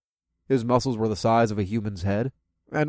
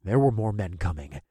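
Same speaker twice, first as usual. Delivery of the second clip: plain reading voice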